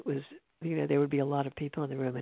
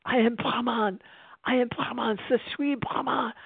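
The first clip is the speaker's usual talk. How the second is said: much higher-pitched mock French-accented cry; imitated French accent; 8 semitones higher than usual. Storyteller imitates a French Canadian teacher's outburst, French-accented mock shouting. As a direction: proclaim it in a put-on French accent. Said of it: "He was, had a French accent".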